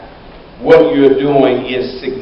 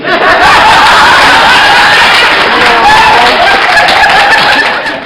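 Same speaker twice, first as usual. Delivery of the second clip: a long, loud, high shout of laughter; roaring laughter; 10 dB louder than usual. Real, not acted. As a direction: let out a big laughing whoop. Big laugh after punchline.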